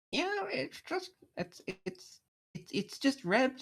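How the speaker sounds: high, small creature voice; squeaky little critter mumbling; Ice Slob's inner voice. Acted. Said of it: "I have sort of two different voices for Ice Slob".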